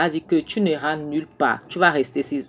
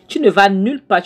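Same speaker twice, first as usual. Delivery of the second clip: higher, louder threat; angry shout; 5 semitones higher, 7 dB louder than usual. Acted. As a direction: bark the threat at her as he grabs her hand. Jonathan grabs Clara's hand, threatens.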